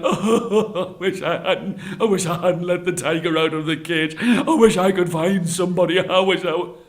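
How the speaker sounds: loud, hammed-up sobbing lament; wailing. Brahmin regretting freeing the tiger, wailing. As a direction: wail and yell through mock sobs. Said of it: "screamed and yelled and cried".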